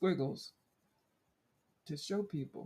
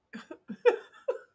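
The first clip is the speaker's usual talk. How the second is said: high, loud airy cackle; sudden high giggle fit; burst of laughter; 10 dB louder than usual. Real, not acted. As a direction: crack up at her own joke. Laughing at her own corny joke.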